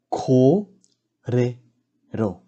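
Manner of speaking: "Ko, re, ro" as three separate beats; slow syllable-by-syllable demo; broken into syllables. Shown as a demonstration. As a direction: sound out each syllable with gaps between. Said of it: "break down the words first".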